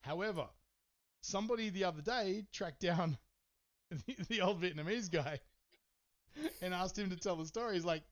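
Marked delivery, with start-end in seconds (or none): none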